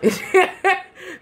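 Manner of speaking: high burst of laughter; high amused cackle. Real, not acted. Laughs at own joke.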